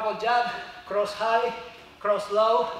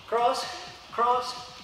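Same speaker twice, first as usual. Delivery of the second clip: boxing coach calling combos; 3 semitones higher, 5 dB louder, slower than usual. Real, not acted. Coach shouting strikes on the bag.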